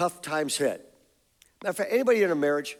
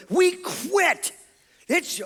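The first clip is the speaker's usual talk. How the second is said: mimicking crying daughters; 13 semitones higher, much slower than usual. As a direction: imitate teenage girls wailing they quit. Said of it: "they're crying, they're like".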